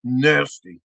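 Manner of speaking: low, quick "Nasty"; disgusted verdict. Acted. Comic judging the special sauce, low disgust.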